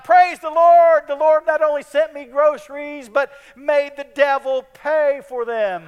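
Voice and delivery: put-on an old lady's overjoyed praise, slowed and loud; jubilant shout. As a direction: shout her triumph to the sky. She jumps up and down.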